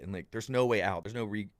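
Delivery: usual speaking voice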